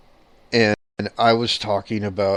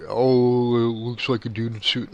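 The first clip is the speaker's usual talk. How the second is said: mocking. Investigator mimicking people who tear the footage apart, mockingly. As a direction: quote the debunkers mockingly, drawing out the "Oh".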